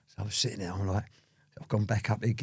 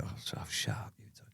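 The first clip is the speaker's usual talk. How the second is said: whispered shut up you two; 3 semitones lower, 13 dB quieter than usual. Retold or imitated. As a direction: hush them under his breath. Retold whisper to arguing cops.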